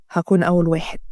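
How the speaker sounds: low-pitched male promise; reassuring man. Acted. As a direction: drop the pitch and reassure her. Man echoes her line as promise. (صوت رجل مطمئن، منخفض الطبقة)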